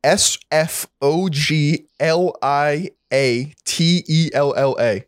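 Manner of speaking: slow, letter by letter; spelling out. Shown as a demonstration. Co-host showing the spelling, slow and separated. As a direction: spell it deliberately, a pause after each letter. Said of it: "this is how you spell it".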